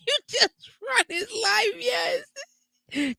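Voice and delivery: high shrieking laugh; real burst of laughter at a friend's story; laughing hard. Real, not acted. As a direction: crack up, words through laughter. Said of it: "hysterical laugh".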